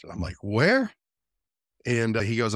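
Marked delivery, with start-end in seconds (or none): 0.4-0.9 high, incredulous question; surprised. Retold or imitated. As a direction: ask it in disbelief. Retelling disbelief at the Congo Basin.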